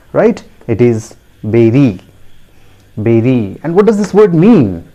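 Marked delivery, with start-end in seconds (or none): 1.4-2.0 "beri" as BEH-ree, falling; correct pronunciation. Shown as a demonstration. Teacher having learners repeat, "beri" as BEH-ree. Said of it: "the phonetic transcription also". 3.0-3.6 "beri" as BEH-ree, falling; correct pronunciation. Shown as a demonstration. Teacher having learners repeat, "beri" as BEH-ree. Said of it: "the phonetic transcription also".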